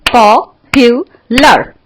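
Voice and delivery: Popular in three slow, punched beats; split into syllables. Shown as a demonstration. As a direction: break popular as पॉ, पु, लर with pauses. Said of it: "बजा के देखो ताली". (Popular को तीन हिस्सों में, रुक-रुककर)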